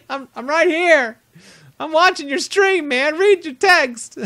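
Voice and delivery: cracking up while pleading to be noticed; high, loud laughing plea. Real, not acted. Laughing at being overlooked.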